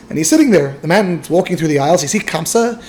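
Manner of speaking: usual speaking voice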